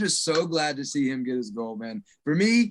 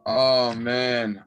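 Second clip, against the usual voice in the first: ordinary delivery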